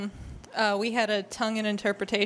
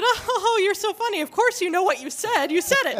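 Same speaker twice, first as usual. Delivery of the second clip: high, loud mock chuckle, then insistence; imitated excited amusement; 9 semitones higher, 4 dB louder than usual. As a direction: tease as the woman sure it's a joke. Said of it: "very excitedly".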